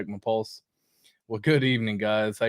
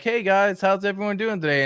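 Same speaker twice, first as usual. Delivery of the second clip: higher, cheery mock greeting; imitating Richard Hart; 7 semitones higher than usual. Vlogger imitating Richard Hart's tweet greeting, higher and cheery. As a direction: chirp it like a friendly host saying hi. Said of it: "he comes in and he's like".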